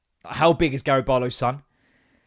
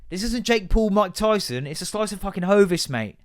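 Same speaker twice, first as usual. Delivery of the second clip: normal talking voice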